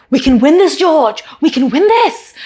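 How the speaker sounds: pushy coach on the radio, lightly voiced; imitating team boss yelling. Team boss yells over radio at driver, mock excited. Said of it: "he's just, like, yelling".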